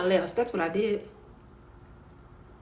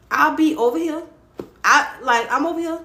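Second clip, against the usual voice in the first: sassy refusal; 5 semitones higher, 3 dB louder than usual. Retold or imitated. Repeated high refusal.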